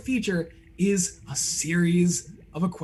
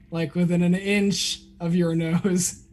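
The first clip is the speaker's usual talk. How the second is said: usual speaking voice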